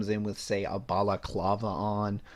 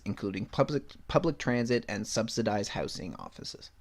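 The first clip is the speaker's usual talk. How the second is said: ordinary talk, nothing marked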